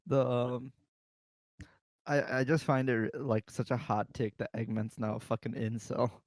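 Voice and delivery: ordinary talk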